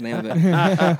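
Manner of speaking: sheepish laughing aside. Real, not acted. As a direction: admit it with a laugh. Laughs at forgetting book.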